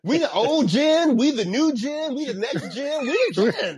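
high, mock-swaggering chant; Djokovic boasting. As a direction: puff out the chest and parody a champion's brag, pitched high. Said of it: "put his chest out".

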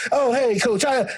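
bright, lifted, overly friendly hello; mock friendly greeting. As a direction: greet the favored coach with fake cheer. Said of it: "They get to".

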